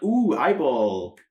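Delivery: lightly hammed spooky "ooh"; cartoon horror. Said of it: "It's, like, cartoon horror".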